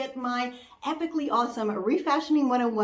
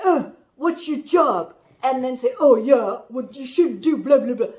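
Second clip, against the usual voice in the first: mock critic; 7 dB louder than usual. Imitating rude critics on the street.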